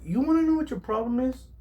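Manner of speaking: high-pitched retold retort; challenging question. Chef's retort, acted out.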